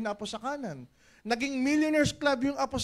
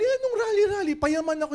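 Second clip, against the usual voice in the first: loud, much higher, brushing off the warning; defiant shout; 9 semitones higher, 6 dB louder than usual. Acted. Leader mocking the rally warning, loud and high. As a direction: shout it back like nothing stops you.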